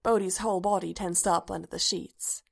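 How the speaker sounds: ordinary delivery, nothing marked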